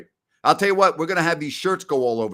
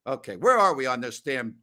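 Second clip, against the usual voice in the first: usual speaking voice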